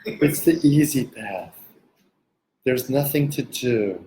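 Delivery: slow, drawn-out parody of a spaced-out teacher; hammed-up serene guru, unhurried; mock sedated guru. Mocking teachers who act non-dual, slow mock guru voice. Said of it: "Talking like, you know, they're on some kind of sedative".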